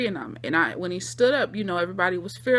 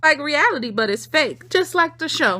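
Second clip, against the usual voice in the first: Suzanne voice; 6 semitones higher, 5 dB louder than usual. Retold or imitated. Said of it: "She said".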